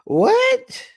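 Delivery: very high squeal of disbelief; incredulous. Acted. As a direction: squeak it in comic shock. Reacts to offensive remark.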